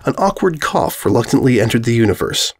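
ordinary delivery

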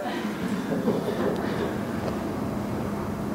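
long, soft breathy sigh; acted sigh. Therapist trying to empathize with both partners, long sigh. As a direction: let out a long, quiet sigh. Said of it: "And I do this".

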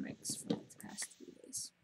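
fast hushed whisper; sheepish whispered admission. Real, not acted. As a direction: confess it in a whisper. Whispered confession.

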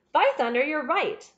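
a loud burst of sudden realisation; excited exclamation. Acted. Sir Henry realising where the words came from, loud and excited. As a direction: blurt it out, struck by the answer.

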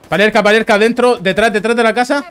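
loud excited warning; urgent shouted callout. Real, not acted. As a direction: shout the enemy position.

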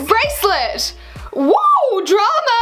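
high gleeful whoop; excited squeal. Acted. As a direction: cheer it like a giddy kid. Gushing over a boy's gift.

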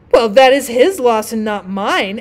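loud, slow, self-important retort; pompous rocket. A narrator's reading. Rocket answering the dragonfly, loud and pompous. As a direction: brush it off grandly, drawing it out.

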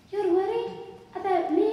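put-on high, loud, happy child voice. A narrator's reading. Child hearing the adult is worried, happy. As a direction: lift it high in childlike delight. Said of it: "the child said happily".

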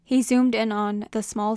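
ordinary delivery

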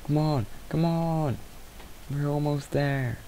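slow sing-song coaxing of an animal. Acted. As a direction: gently lure a pet along. Coaxing animal toward home.

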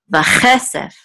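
"vachesef" with a high, accented "che"; stress on CHE. Shown as a demonstration. Teacher showing the accented syllable, "che" in "vachesef" stressed. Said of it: "So the accent is on this syllable".

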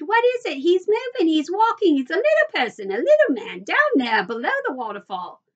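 put-on high, loud girlish outburst; excited scream. A narrator's reading. Veruca sees a tiny man by the waterfall, screaming excitedly. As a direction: shriek it out in amazement. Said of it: "she screamed".

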